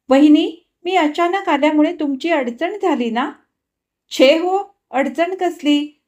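polite back-and-forth over tea; women's polite exchange. Acted.

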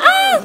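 sudden very high loud shriek; short panicked scream; shocked scream. Real, not acted. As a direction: shriek as you fall in the lava. Dies in the lava.

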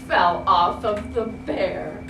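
plain reading voice

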